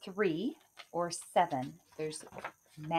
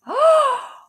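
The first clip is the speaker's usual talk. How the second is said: loud high startled gasp; sharp gasp; 12 dB louder than usual. Acted. Kate sees the giant beanstalk, sharp gasp. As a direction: gasp in shock. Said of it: "She gasped".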